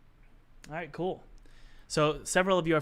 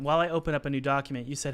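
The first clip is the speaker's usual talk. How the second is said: normal talking voice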